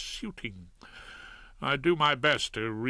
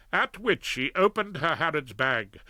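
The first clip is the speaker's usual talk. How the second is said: plain reading voice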